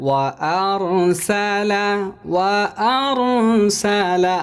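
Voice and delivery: "আরসালা" chanted slowly, no extra stretch; "আরসালা" sung high and slow; recited without lengthening. Shown as a demonstration. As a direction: recite "ওয়া আরসালা" evenly, vowels short. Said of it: "আমরা এগুলোর মধ্যে লম্বা করব না".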